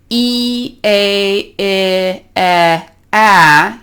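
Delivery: E, A, I, E, A as separate held vowels; vowel ladder demo. Shown as a demonstration. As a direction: draw out each vowel, E, A, I, E, A, opening gradually. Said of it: "make the vowel lower and lower".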